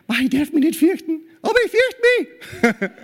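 high, strained worried voice rising to a squeak; a believer panicking at his own fear; anxious inner voice. Pretend. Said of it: "Dass wir denken".

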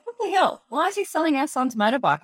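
retelling a stunned, outraged discovery; shocked outburst. Retold or imitated. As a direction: pitch jumps way up on what the hell. Retold shock at discovering sale.

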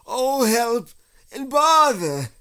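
high, loud wail from a stuck bear; panicked cry for help. Acted. Stuck Pooh calls for help, panicked.

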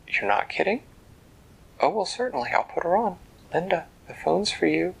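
put-on thrilled disbelief, then call the child; amazed mom.

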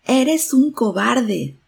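loud, high, slow furious call; angry shout. A narrator's reading. Angered boy shouts at the unknown voice, slow loud angry yell. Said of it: "se enojó y gritó".

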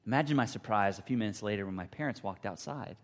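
ordinary delivery

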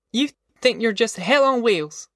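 put-on high-pitched scornful girl's voice; indignant. A narrator's reading. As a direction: tell him off in a high voice. Girl scolds Al in quarrel.

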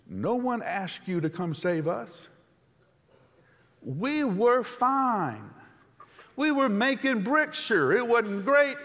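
high, loud griping; whiny complaining. Acted. Hebrews griping at Moses in a high, loud whine. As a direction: gripe at Moses like a fed-up crowd. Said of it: "The Hebrews tell Moses".

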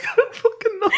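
squeaked out through laughter; very high, breathless with giggles; helpless laughter. Real, not acted. Cannot speak for laughing.

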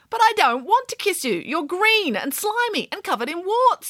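whiny princess. A narrator's reading. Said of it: "said the princess".

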